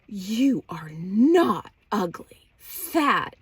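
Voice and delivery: slow, drawn-out, loud imitation of mom; emphatic mock reassurance. Mom reassuring her daughter she isn't ugly, exaggerated and slow. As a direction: stretch each word with exaggerated emphasis. Acting out mother's reaction again.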